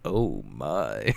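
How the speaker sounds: very low, drawn-out oh my; deep amused catchphrase; George Takei oh my. Retold or imitated. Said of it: "That's what it sounded like to me".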